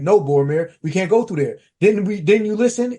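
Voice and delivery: normal talking voice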